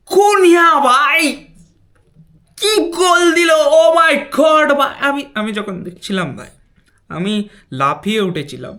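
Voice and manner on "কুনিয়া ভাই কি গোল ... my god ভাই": excited shout. Real, not acted. Goal hype, shouting the name.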